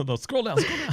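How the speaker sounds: high, rising urgent pleading. Retold or imitated. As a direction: beg them to scroll down. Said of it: "Oh, you're like".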